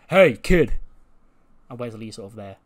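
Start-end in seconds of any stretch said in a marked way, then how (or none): 0.1-0.7 imitated confrontational call, high and loud; much louder, higher mock challenge. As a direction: call someone out as a retold wrestler. Retold wrestler calls out aggressively.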